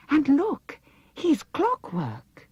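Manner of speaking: high delighted gasp of wonder; excited. A narrator's reading. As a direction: marvel at the discovery. Dorothy discovers clockwork man.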